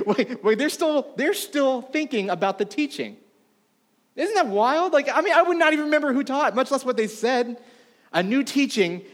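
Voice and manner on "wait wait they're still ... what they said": high-pitched, laughing incredulity; amused disbelief. Acted. Mock disbelief at crowd's reaction.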